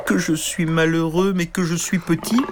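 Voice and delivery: plainte lente et aiguë; gémir lentement, perdu; gémissement. Acted. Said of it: "Il gémissait tant et tant". (gémissement lent et aigu)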